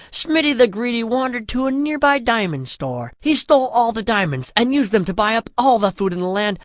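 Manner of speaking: usual speaking voice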